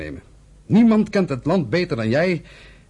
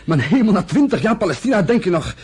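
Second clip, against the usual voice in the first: higher and louder, scornful; scornful brother, raised voice; scornful outburst; 7 semitones higher, 4 dB louder than usual. Acted. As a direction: blast him with contempt.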